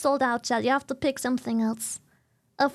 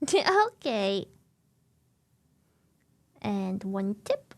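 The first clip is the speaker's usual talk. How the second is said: usual speaking voice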